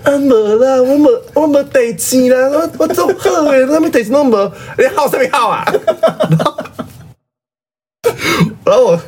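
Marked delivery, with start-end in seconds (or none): 0.0-5.6 put-on high, fussy old-woman voice brushing it off; imitating grandma. Grandma insisting to her daughter she's fine, high mock-old-lady voice. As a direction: play the grandma waving off her daughter, pitched way up. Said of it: "我外婆就说".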